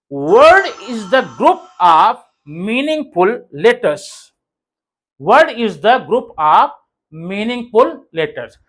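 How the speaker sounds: very slow, word by word; slow dictation. Shown as a demonstration. Teacher giving learners a definition, slow measured dictation. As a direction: dictate it with a pause after each phrase.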